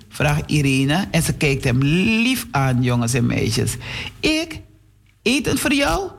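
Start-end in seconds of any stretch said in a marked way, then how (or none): 4.2-6.1 high mocking "me?" voice; mocking disbelief. Boy scoffs at hungry girl asking for food, mocking. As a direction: scoff at the very idea, pitched up high.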